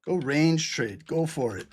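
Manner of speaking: slow, drawn-out mocking permission; teasing a reckless viewer; sarcastic mock advice. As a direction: dangle the bad advice slowly. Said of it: "Let's give him his trade".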